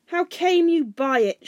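high, loud, slow demand; raging shout, each word drawn out; shouted in gathering rage. A narrator's reading. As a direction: bellow it with rising fury. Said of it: "shouted Thorin in gathering rage".

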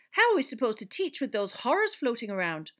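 mildly sharp indignant question. A narrator's reading. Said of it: "said Professor McGonagall sharply".

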